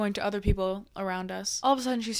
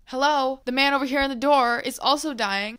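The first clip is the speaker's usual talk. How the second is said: higher and louder call for attention; 4 semitones higher, 7 dB louder than usual. Retold or imitated. Calling the paramedics over to the dying man, higher and louder. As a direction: flag the paramedics down. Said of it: "And I'm like".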